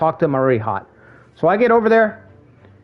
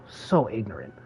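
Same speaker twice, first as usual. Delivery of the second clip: breathy whispered aside; 6 semitones lower, 10 dB quieter than usual. Real, not acted. Streamer to his viewers about the rude customer, whispered. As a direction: whisper it in disgust.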